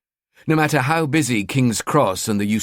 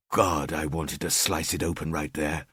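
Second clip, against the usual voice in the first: dark longing; 6 semitones lower, 7 dB quieter than usual. A narrator's reading. Killer's dark desire.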